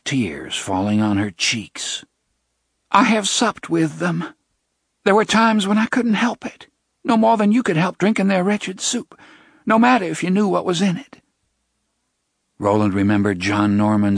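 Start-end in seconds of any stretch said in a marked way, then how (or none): 2.9-11.2 put-on high, pleading young woman's voice; tearful young woman. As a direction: confess it near tears. Jenna confesses tearfully.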